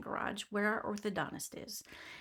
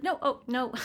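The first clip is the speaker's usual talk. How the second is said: flustered denial; 4 semitones higher, 6 dB louder than usual. Retold or imitated. Acted protest at police accusation.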